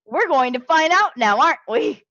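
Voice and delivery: ordinary talk, nothing marked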